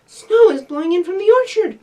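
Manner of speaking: high, loud alarmed cry; puppy bursting in with news of snow; gasped. Acted. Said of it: "gasped Fletcher".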